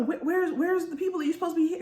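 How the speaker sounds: high-pitched, hurried alarm; acted frantic steward; flustered panic. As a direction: stammer it in mock panic. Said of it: "he's having this heart attack".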